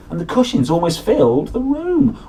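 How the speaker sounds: ordinary delivery, nothing marked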